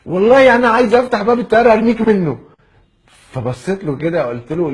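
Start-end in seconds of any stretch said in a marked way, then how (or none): 0.1-2.4 loud, high-pitched fervour; mock impassioned shouting; hammed-up outburst. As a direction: burst out as an overwhelmed fan. Comic repeat of admirer's outburst.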